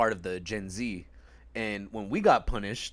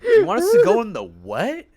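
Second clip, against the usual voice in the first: much higher and louder disbelief; can't believe the order; imitated outraged surprise at mom; incredulous sister; 12 semitones higher, 13 dB louder than usual. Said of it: "Ashley's like".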